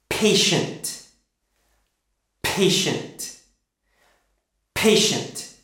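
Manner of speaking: Patient with a strongly aspirated P; Patient, exaggerated P puff. Shown as a demonstration. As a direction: pop the P of Patient hard. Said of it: "Make it very strong".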